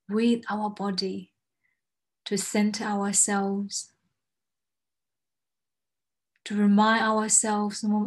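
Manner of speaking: slow, soothing meditation guide; guided meditation. Acted. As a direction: ease them into calm breathing. Leads a grounding exercise.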